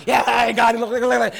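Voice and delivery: loud, eager calling; excited worshiper. Retold or imitated. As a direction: beg God to look like a thrilled pup. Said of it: "you're like".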